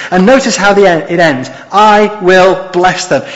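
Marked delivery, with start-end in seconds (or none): 1.7-3.2 slow, each word weighted; emphatic promise. Acted. Preacher giving God's closing promise, slow and emphatic. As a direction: spell out the promise firmly and slowly. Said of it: "It's an emphatic end".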